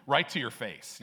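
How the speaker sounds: ordinary delivery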